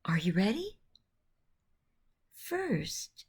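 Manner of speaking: usual speaking voice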